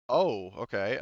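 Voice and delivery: ordinary talk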